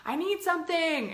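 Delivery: mock child's cry for attention; crying inner child. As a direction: wail it like a needy little kid, hammed lightly. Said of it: "and it's crying".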